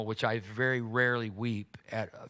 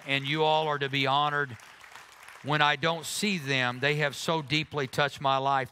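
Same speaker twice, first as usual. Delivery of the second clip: rising praise; 3 semitones higher, 4 dB louder than usual. Acted. Pastor honors couple before congregation.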